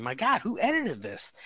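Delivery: high-pitched incredulous inner voice; mock dismay. Retelling a reaction to the out-of-sync video, mock appalled. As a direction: pitch it up in exasperated disbelief.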